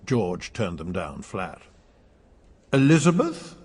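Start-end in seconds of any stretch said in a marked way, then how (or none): 2.7-3.6 higher, louder timid suggestion; a nervous father's try; hopeful question. Acted. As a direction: offer the name hopefully.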